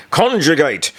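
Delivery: loud, high barked order; roared command. Acted. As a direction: roar it at the whole class. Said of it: "Führer Blüchner roared".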